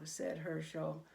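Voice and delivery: usual speaking voice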